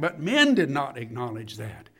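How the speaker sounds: ordinary talk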